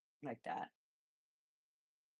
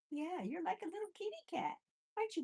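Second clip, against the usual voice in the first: much higher doting pet voice; 12 semitones higher, faster than usual. Real, not acted. Fussing over Buddy the guinea pig, high baby talk.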